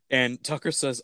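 ordinary talk

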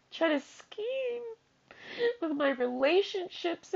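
high, sobbing plea; real crying. Real, not acted. Pleading not to be hurt or betrayed, crying. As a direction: beg through tears, voice pushed high. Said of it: "I'm gonna start crying again".

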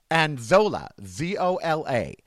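ordinary talk

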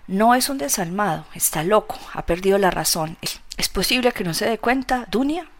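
usual speaking voice

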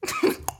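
very high, soft squeak; squeaky death sound effect. Pretend. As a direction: make a tiny squeal for someone dying. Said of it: "that's my sound effect for Trump kicking it".

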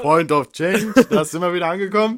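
loud amused outburst. Real, not acted. S0 pouncing on the friend's Point of Change, loud amused outburst. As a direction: burst out loudly with amusement. Laughing echo of friend's catchphrase.

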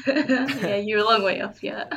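talking through a laugh. Real, not acted. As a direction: laugh as you speak. Teasing reply, laughing while talking.

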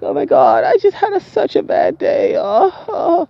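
very high, whimpering damsel in distress; hammed-up sobbing in a squeaky falsetto; mock crying. Storyteller imitating a woman crying outside the hospital, mock tearful wail.

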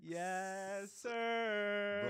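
loud high hype call; hype shout. Acted. Hosts' intro catchphrase shout.

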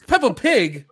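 high, loud disbelief; incredulous echo. Real, not acted. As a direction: repeat it high and loud, mock-offended. Indignant echo of listener's tease.

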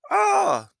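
loud, very high drawn-out "ahh"; high exclaimed "ah". Acted. Driver thinking they have finally arrived, high loud "ahh". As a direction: let out one sustained, high-pitched cry.

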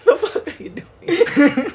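squealing with laughter, slow and high; nervous laughter. Real, not acted.

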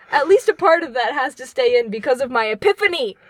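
normal talking voice

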